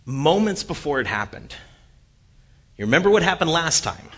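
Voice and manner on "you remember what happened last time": higher, louder, fast warning; a hint of a warning father; dad's warning. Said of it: "my dad saying too".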